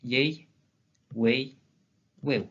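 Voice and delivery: three-vowel glides, each said separately; isolated demonstration of "wei" and "weu"; triphthongs. Shown as a demonstration. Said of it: "des triphtongues de trois voyelles".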